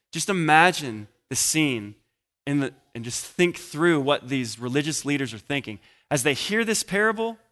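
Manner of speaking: normal talking voice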